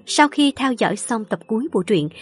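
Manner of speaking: loud formal announcer; radio announcer. Acted. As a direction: address the listeners formally. Closing announcement to listeners.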